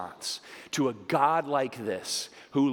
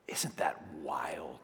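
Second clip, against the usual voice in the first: slow, hushed whisper of wonder; awed whisper; 8 semitones lower, 7 dB quieter, slower than usual. Real, not acted. A preacher marvelling to the congregation, whispered. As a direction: whisper it in awe.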